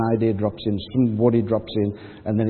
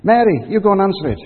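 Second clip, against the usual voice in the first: high, loud, jittery push to answer the door; a frightened disciple hamming it up, shoving Mary forward; nervous urging; 8 semitones higher, 6 dB louder than usual. Retold or imitated.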